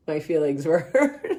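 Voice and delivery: amused mock-hurt husband, giggle breaking out; laughing as she imitates the confession; laughing retold admission. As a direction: quote him through a laugh, breaking up at the end. Laughs while quoting husband.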